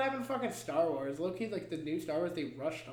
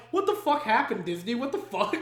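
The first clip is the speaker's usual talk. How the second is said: higher, louder, exasperated rant; exasperated outburst; 4 semitones higher, 5 dB louder than usual. Retold or imitated. Friend scolding Disney, exasperated outburst. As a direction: throw it at Disney in disbelief. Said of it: "And I'm like".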